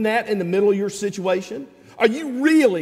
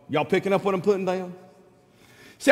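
normal talking voice

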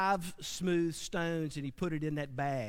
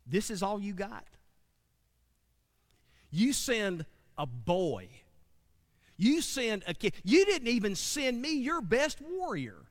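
higher and mocking, building up; Goliath taunting; 4 semitones higher than usual. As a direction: sneer down at the boy. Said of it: "Goliath stood out there and said".

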